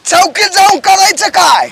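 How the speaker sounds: loud, high protest; man raising his voice at the policewoman; too loud. Acted. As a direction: shout the question back at her. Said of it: "तुला बोले ना, हळू बोल".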